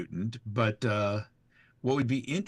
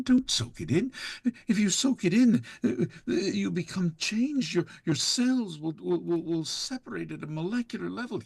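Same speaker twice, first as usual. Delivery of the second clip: Irradiated Chaos; 9 semitones higher than usual. Acted. Irradiated Chaos persona ranting about radiation, high and frantic. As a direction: rattle it out in a manic panic. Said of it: "And Irradiated Chaos".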